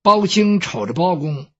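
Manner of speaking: plain reading voice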